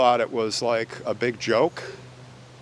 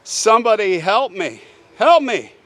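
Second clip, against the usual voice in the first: retold screaming; 5 semitones higher, 4 dB louder than usual. Stabbed woman begs the neighbors for help, screaming in retelling. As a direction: imitate desperate screaming, rising to the final plea. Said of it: "She is screaming".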